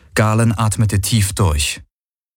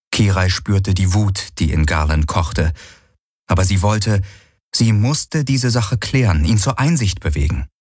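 usual speaking voice

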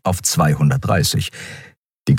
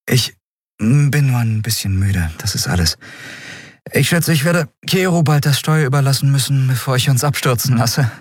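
slightly raised voice of a weary ship's captain; lightly voiced tired captain; 8 semitones higher than usual. Tired captain admits she must soon hand over the helm, lightly voiced.

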